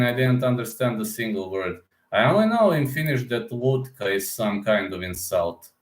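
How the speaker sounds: ordinary talk, nothing marked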